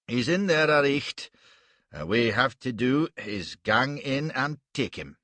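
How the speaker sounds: loud Scots burr with Malay exclamations; a huge warrior speaking broad Scots; Scottish accent. Acted. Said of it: "in that astonishing accent".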